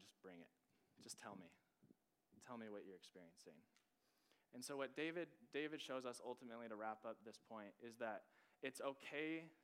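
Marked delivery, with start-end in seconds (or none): 0.0-3.6 gentle God's voice. Retold or imitated. God voiced gently to speaker.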